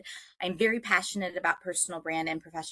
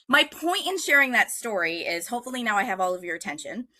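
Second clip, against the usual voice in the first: ordinary delivery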